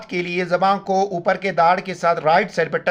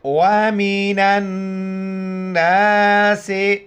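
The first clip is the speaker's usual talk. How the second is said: slow, chanted tajweed recitation; Quran recitation. Shown as a demonstration. Teacher recites the verse for students to repeat after. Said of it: "غنہ کریں گے".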